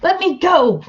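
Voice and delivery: terrible actor voice; bad acting. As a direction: ham it up, high and stagey. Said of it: "I say in a terrible actor voice".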